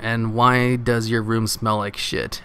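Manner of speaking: ordinary talk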